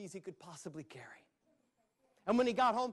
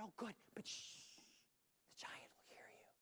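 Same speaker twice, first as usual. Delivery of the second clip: urgent unvoiced whisper; 2 semitones higher, 19 dB quieter than usual. Captive princess warning Jack about the giant, whispering. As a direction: hush him so the giant won't hear.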